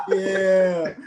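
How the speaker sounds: bursting into a high laugh; laughing before the bit. Real, not acted. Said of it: "give us a voice".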